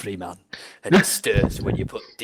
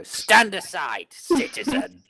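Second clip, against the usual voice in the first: high, loud, slow command; drawn-out officer's call, pitched up; old captain's charm; 10 semitones higher, 5 dB louder, slower than usual. Acted. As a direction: order it like a pompous old captain. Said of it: "employ my old captain's charm and go".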